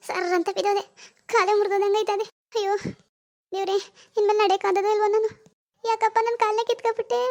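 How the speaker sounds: high, tearful tale of woe; hammed-up wailing to the heavens; put-on crying. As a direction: whine through a staged injury, building to sobs. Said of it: "ಮತ್ತೆ ನಾಟಕ ಆಡ್ತಿದ್ದೀರಾ".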